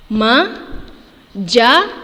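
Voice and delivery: Majalah stretched syllable by syllable; teacher splitting Majalah slowly. Shown as a demonstration. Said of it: "bagaimana dia dipenggal".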